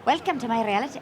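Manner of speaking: aim for Irish and land in Scottish; tentative imitation of a Scottish-tinged accent; Scottish-sounding accent attempt. Shown as a demonstration. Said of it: "that's Scottish".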